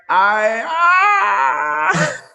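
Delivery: a word cut off by a high, loud yelp; mock scream. Retelling the moment the instructor jumped with her, high loud yelp. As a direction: break off into a startled shriek. Retold being jumped out of plane.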